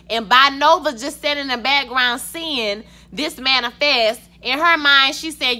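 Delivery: ordinary talk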